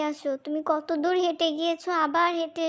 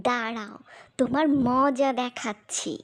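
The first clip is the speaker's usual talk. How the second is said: ordinary delivery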